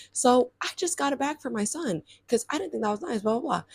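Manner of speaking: soft, measured, self-justifying mock voice; imitated defensive mom. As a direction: imitate a mom politely excusing herself for her son. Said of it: "she was basically just giving".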